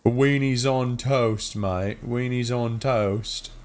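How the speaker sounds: put-on slow, drawn-out British accent; mock British accent. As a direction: savour it like a cartoon Brit. Keeps mocking British speech.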